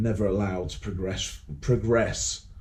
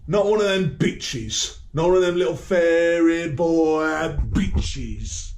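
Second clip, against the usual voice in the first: angry taunt; 5 semitones higher, slower than usual. Real, not acted. Ranting insults at the royals.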